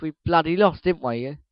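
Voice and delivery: ordinary talk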